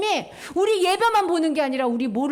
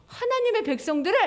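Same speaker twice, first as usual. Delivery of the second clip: ordinary delivery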